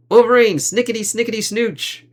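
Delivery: playful high-pitched slash noises; mock berserker attack noise. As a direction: chant it as a goofy claw-attack sound. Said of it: "imitating Wolverine's berserker attack".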